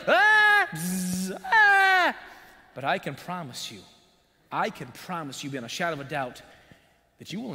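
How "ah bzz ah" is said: high loud screams with a buzz sound between; hammed-up terrified shriek; mock scream. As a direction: scream like riding a zip line in terror. Mock scream down zipline of life.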